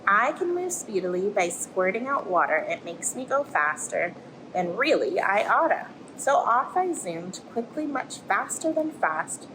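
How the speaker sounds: lively storybook voice for little ones; reading to children. Acted. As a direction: tell it like a bedtime tale, rising and falling. Reads picture book to children.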